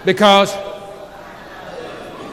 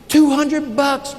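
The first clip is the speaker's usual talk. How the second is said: slow reveal; 3 semitones higher, much slower than usual. Acted. Dramatic reveal of client's answer.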